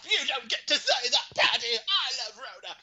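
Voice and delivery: mock angry retort. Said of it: "And he's like".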